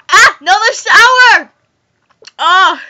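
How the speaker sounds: loud, drawn-out shout. Real, not acted. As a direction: shout it out in shock. Sour drink surprise.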